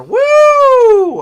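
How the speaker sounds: high loud woo; cheer. Real, not acted. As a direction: let out a big whoop. Cheering on livestream.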